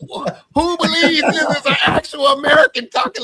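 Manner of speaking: high-pitched shout of amused disbelief; loud disbelief. Real, not acted. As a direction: cry out, incredulous at the gullibility. Mocks scammers' un-American phrasing.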